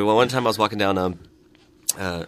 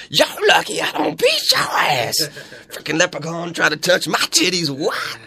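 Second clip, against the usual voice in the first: imitated angry woman; 4 semitones higher than usual. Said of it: "she goes".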